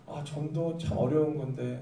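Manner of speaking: soft, low reluctant sigh; quiet, weary mock reluctance; burdened sigh. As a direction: sigh it out like it's a heavy chore. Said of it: "부담감을 느끼더라는".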